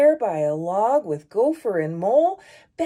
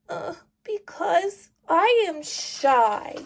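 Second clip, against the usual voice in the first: trembling shy voice; 7 semitones higher than usual. Acted. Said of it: "a trembling voice says".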